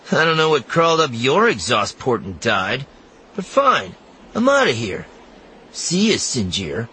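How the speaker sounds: put-on a kid giving up and walking off; annoyed boy. A narrator's reading. Temin storms off, annoyed.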